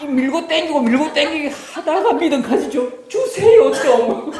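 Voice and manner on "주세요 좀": 높이 솟구치는 떼쓰는 간청; begging plea. Retold or imitated. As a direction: whine up high like a begging child. 간청하는 기도 흉내. (높고 크게 조르는 간청)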